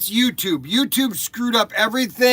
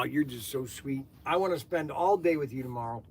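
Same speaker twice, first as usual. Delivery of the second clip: tender; 6 semitones lower, 9 dB quieter than usual. Real, not acted. Tender words to grandchild.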